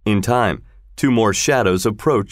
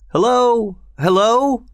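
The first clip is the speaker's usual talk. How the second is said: yelling; 13 semitones higher, slower than usual. A narrator's reading. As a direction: call out across the garden at night. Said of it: "yelled Launcelot into the night".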